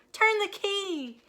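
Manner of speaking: high loud slow shout; urgent order. A narrator's reading. Minnie shouting to Moo. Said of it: "shouted Minnie".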